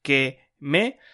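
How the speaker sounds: drawn-out teacher demo, word by word; slow example reading. Shown as a demonstration. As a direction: slowly spell out the Spanish examples. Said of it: "like for example".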